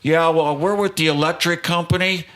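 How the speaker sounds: bluffing friend. Retold or imitated. Friend talks his way past the doorman, bluffing. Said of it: "and Reed goes".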